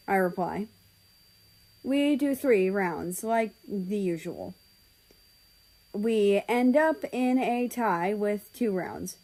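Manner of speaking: usual speaking voice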